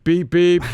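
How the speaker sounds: high, loud beep beep; mock car horn. Driver honks at me outside, mock horn beep. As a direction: voice the honking car. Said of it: "they're like".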